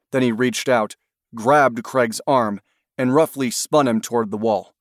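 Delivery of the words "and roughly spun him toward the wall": ordinary delivery, nothing marked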